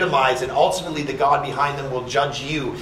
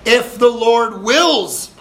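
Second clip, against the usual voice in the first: slow, hushed, humble words; slow humble quote; 7 semitones higher, 4 dB louder, much slower than usual. Acted. Voicing the humble believer for the church, slow and meek. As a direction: lay it down gently and slowly.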